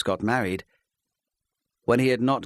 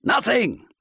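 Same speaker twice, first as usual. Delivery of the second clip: loud, much higher shout of Nothing; 6 dB louder than usual. Acted. Husband shouting down his wife's question, loud. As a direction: bark the denial at her. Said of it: "he shouted".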